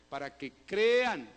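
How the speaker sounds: "crean" punched loud and drawn out; stress on "crean". Shown as a demonstration. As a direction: lean hard and slowly on "crean". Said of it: "¿Cuál es el énfasis de todos estos textos?". (énfasis fuerte y alargado en "crean")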